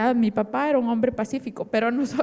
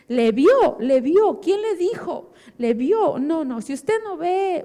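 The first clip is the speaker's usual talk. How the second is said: high, loud insistent challenge; frantic disbelief; 5 semitones higher, 5 dB louder, slower than usual. Retold or imitated. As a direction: demand proof, refusing the news. Refuses news of father's death.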